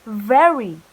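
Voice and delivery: Very with a strong buzzing V, loud; bold V, loud. Shown as a demonstration. Teacher modelling Very for the class. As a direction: hit the V in Very hard. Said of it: "repeat after me".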